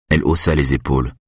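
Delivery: ordinary delivery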